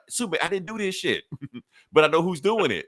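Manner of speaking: high, pleading protest; frantic Luthor. Retold or imitated. Luthor pleading with Superman, high frantic protest. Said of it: "He's like".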